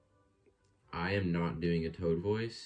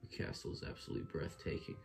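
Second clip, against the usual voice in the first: soft, breathless whisper of wonder; hushed awe; 9 dB quieter than usual. Acted. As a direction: whisper it in awe, barely voiced. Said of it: "That's probably what he sounds like".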